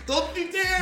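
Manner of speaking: high, loud laughing yell; playful shouting at geese. Pretend. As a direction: warn off an attacking goose, laughing. Acting out facing a charging goose.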